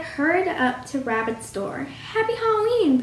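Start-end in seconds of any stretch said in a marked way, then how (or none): 2.1-3.0 high-pitched cheerful Halloween greeting; cried out. A narrator's reading. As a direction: call it out high to a neighbour's door. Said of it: "Pooh cried".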